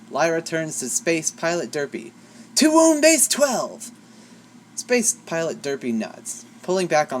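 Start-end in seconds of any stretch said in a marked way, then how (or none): 2.6-3.9 high, slow, ringing order; rallying cry. A narrator's reading. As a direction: call it out like a space hero.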